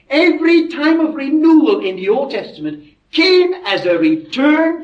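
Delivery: emphatic preaching. Real, not acted. Preacher telling of revivals and kings, emphatic preaching. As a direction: drive each repeated phrase home from the pulpit.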